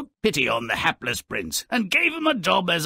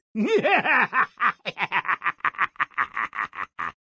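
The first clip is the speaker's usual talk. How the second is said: gleeful cackle; 6 semitones higher than usual. Real, not acted. Laughs after gloating.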